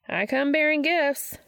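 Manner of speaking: high, drawn-out sing-out call. A narrator's reading. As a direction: announce yourself from the next room. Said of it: "another voice calls out from the living room".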